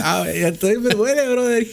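laughing at his past self at the hospital, mock whine of pain; mock pained patient. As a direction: ham up your own agony a little. Mimicking himself in pain.

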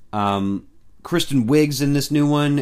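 ordinary talk, nothing marked

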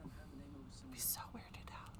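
whispered under breath, slow. Real, not acted. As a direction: whisper it to yourself. Whispered repeat.

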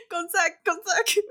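high, loud, laughing repeat of the name; laughing correction. Real, not acted. Correcting herself to viewers, laughing and squealing. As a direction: giggle the fix out loud.

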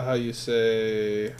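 very slow, drawn-out how you say; mock searching. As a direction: drag it out like searching for a word. Groping for word, mock phrase.

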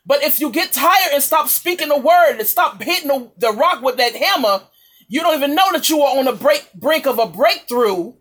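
usual speaking voice

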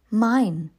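ordinary delivery

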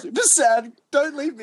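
hammed-up emotional plea; mock sad. Begging podcast mates not to leave, mock sad pleading. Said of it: "You sound pretty emotional".

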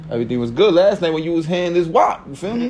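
high, mocking disbelief; mocking incredulous. Mocking the boyfriend.

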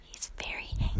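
hushed, whispered commentary; whispered aside. Pretend. Wife tells listeners about angry husband, whispered aside. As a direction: whisper it confidentially to the audience.

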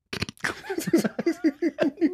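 high, loud burst of laughter. Real, not acted. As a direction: burst out laughing, shrill and helpless.

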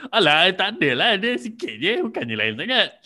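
1 mocking the partner's dismissal. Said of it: "and you're like".

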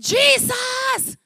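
high, drawn-out shout; crying out. Retold or imitated. Preacher acts out the blind beggar calling to Jesus, crying out. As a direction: call out to be heard, voice high. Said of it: "blind Bartimaeus cried out".

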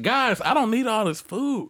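high, drawn-out mock plea; comic high-voiced refusal; pleading protest. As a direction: retell a slow, high objection. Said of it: "I'm like".

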